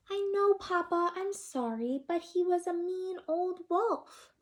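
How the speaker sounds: high pleading chick; sorry but insistent chick; little chick voice. Acted. Chick explaining to Papa, high little voice.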